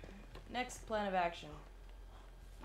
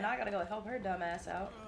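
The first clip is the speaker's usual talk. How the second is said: usual speaking voice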